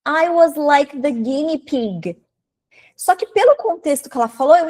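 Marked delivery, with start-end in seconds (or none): 0.1-2.2 slow, carefully spaced English; quoted podcast line. Retold or imitated. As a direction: quote it slowly so learners catch every word. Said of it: "a pessoa me pega e fala assim".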